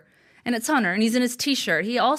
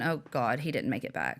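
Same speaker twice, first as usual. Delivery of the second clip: hushed, sinking worry; quiet dismay; 7 semitones lower, 8 dB quieter than usual. Retold or imitated. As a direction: murmur it in dread. And I'm thinking.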